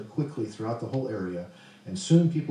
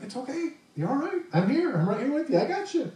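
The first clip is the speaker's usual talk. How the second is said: gentle reassuring God; 13 semitones higher than usual. Retold or imitated. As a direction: comfort a scared child gently. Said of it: "And God's just like".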